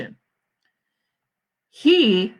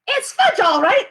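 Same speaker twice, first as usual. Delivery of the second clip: put-on much higher, louder little-kid copy of "It's fudge, all right"; toddler echo; 13 semitones higher, 6 dB louder than usual. Fudge to his mother echoing his brother, high toddler voice. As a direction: parrot it back as a gleeful small boy. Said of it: "my brother repeated".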